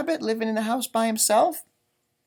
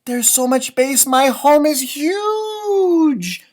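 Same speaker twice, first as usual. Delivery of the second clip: louder, slow delighted reading; happy Rabbit; 4 semitones higher, 7 dB louder, slower than usual. Acted. Reading the rabbit's line again, delighted. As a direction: savour the word huge. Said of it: "It says".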